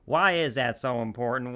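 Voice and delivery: usual speaking voice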